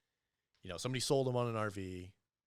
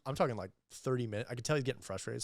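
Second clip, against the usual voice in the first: ordinary talk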